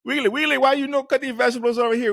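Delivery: high, fast Caribbean accent imitation, yelling Wiggly; mocking coworker's accent. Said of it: "he would say".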